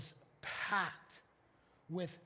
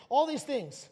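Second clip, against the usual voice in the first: ordinary talk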